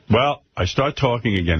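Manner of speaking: ordinary delivery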